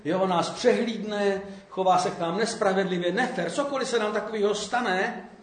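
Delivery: ordinary talk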